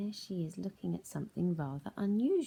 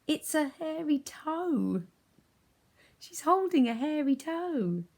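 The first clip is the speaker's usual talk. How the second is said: high, slow, loud hairy toe reveal; spooky reveal; 10 semitones higher, 6 dB louder, slower than usual. Acted. Teacher to children, spooky high reveal. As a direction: draw out the creepy reveal.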